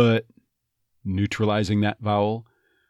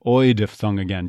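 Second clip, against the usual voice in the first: ordinary talk, nothing marked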